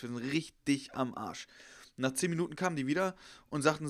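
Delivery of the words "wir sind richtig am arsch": "richtig" drawn out in two slow beats; slow right down and hammer "richtig"; stress on RICHTIG. Retold or imitated. Friends waiting in the car, doomed, "richtig" stretched slowly. Third, slowed repeat of dread.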